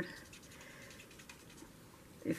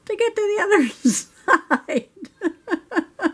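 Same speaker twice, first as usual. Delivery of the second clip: high voice, laughing through the punchline; 13 semitones higher, 4 dB louder, slower than usual. Retold or imitated. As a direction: crack up while delivering the answer. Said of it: "I just looked at him and I laughed".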